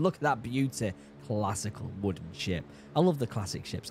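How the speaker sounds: ordinary talk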